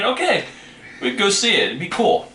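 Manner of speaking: high-pitched, keen mock verdict; upbeat approval. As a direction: play up a five-star thumbs-up. Acts out a five-star verdict.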